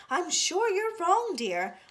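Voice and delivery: high, slow sweet contradiction; gentle protest. Acted. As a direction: younger sister gently disagreeing. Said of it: "said Baby".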